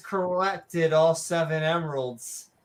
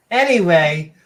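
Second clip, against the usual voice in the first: ordinary delivery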